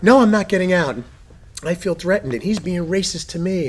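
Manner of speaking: hammed-up indignant passenger; mock flamboyant passenger. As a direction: mimic the passenger playing victim, high and loud. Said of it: "He's just like".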